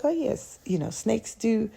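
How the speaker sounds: high and soft, mock casual; mock knowing reply. Answering her friend about snakes shedding, high soft mock-casual. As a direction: pretend to know all about it.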